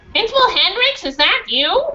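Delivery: angry mother confronting the principal, high and demanding; high demanding woman. Acted. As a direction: call him out sharply. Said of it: "the woman demanded".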